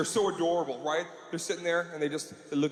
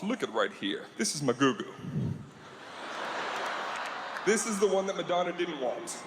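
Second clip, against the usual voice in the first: kindly pitchman; 6 semitones lower than usual. Retold or imitated. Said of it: "He starts telling you some information".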